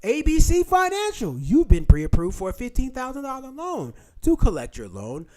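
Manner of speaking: slow, high-pitched fake loan officer; mock scam caller. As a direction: announce the loan in an exaggerated telemarketer voice. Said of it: "They'll call in".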